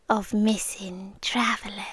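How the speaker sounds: of missing travellers dropped to a low, ominous whisper. Acted. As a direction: let the threat sink into a whisper. Ominous rumours.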